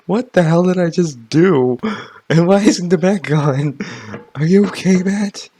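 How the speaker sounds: loud, startled yelling at the screen. Real, not acted. Player yelling at a bat in the game, loud. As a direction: shout in disbelief.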